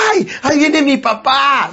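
put-on high, slow child's cheer; excited children. Children seeing their cheerful dad arrive, high and slow. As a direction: sing it out like delighted kids.

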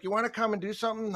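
quick, high mock dare; retold fighting challenge. Timekeeper dares coach to fight, mock belligerent. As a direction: imitate someone picking a fight.

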